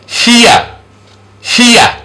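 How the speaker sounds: high, gliding ee-uh in Here; vowel demonstration. Shown as a demonstration. Teacher models the sound, high separate Here. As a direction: pitch each Here up, separately. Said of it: "This is the here".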